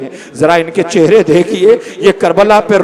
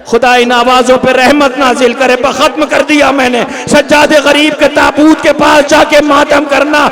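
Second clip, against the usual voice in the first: raised preaching; 7 semitones higher than usual. Acted. Preacher blessing the mourners, fervent raised lament. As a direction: keep the fervour up.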